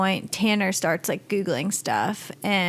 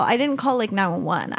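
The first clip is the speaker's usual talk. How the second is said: ordinary talk, nothing marked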